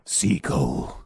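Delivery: very low, solemn title drop; trailer title voice. Acted. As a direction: intone it as a movie trailer sign-off. Mock trailer title tag.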